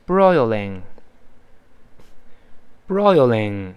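BROILING said singly, falling at the end; model pronunciation. Shown as a demonstration. Teacher models BROILING for students to repeat, clear model reading. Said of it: "那我们把这词再读一遍".

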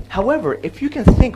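usual speaking voice

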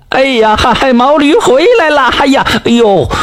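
高声惊呼，嗓门拔高; 老伴喊着报喜，又惊又喜; 院里惊喜地喊. A narrator's reading. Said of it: "老伴在院里就喊开了". (老伴在院里高声喊驴回来了)

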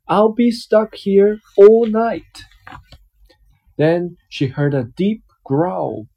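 lively picture-book reading voice; reading to a child. Acted. Dad reading a picture book to his child, storytelling voice. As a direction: read it out like a bedtime story for a little one.

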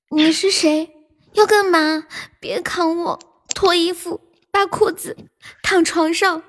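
coquettish. Pretend. Co-host taking over the forfeit reading, coquettish. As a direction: chant it in short flirty phrases. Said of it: "骚一点".

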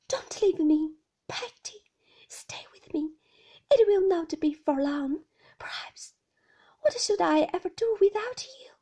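high, plaintive entreaty; pleading mother. A narrator's reading. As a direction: plead with a dear old companion. Mother pleads with her servant.

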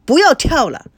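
put-on reproachful shout at a bouncing girl; scolding. A narrator's reading. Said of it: "异口同声地责备道".

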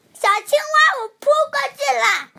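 high, loud warning call as he jumps; excited cry. Acted. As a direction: shout it as you leap. Duckling warns and leaps.

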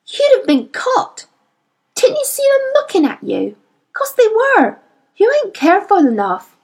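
put-on high, fiery girl voice; a proud young girl standing her ground; Lyra, fierce girl. A narrator's reading. Girl scolding the boy for carelessness, fiery.

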